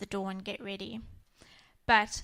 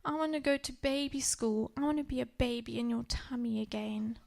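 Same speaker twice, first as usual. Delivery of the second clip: put-on little girl voice; 3 semitones higher than usual. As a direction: voice the small daughter, half whispering. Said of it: "and she said".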